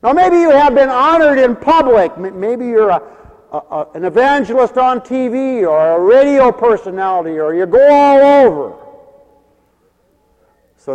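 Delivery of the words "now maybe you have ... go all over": high, loud preacher's challenge; full-force preaching. Acted. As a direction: put it to the congregation loudly.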